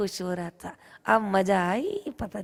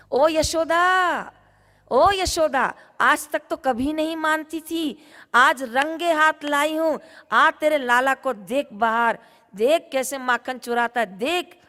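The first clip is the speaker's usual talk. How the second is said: calling out; 4 semitones higher, 4 dB louder than usual. Acted. Gopi calling Yashoda out to see the thief, loud and high. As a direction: summon her loudly to come and see. Prabhavati calls Yashoda outside.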